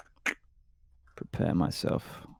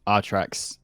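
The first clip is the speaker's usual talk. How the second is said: normal talking voice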